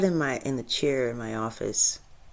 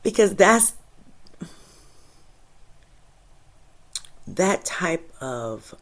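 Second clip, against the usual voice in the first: holding back tears; 4 semitones lower, slower than usual. Real, not acted. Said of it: "I almost feel like crying right now".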